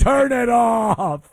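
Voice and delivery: fed-up shout. Real, not acted. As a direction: bellow it, half laughing in disgust. Host fed up with clip.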